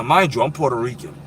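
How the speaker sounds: usual speaking voice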